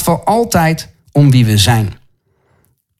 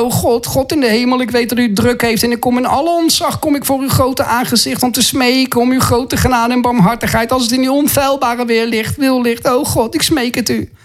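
higher-pitched, put-on pious begging; mock grovelling prayer; 4 semitones higher than usual. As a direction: imitate someone grovelling wordily before God. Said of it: "Je hoeft niet zo te bidden".

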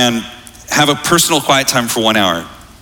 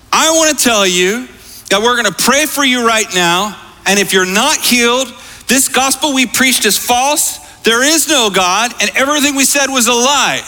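imitated high, emphatic proclamation; bold declaration; 10 semitones higher than usual. Young leader challenges a father before praying, bold declaration. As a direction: pitch it high and declare every phrase firmly.

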